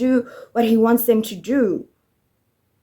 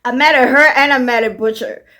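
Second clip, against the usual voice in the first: normal talking voice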